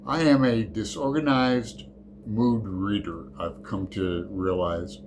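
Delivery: ordinary delivery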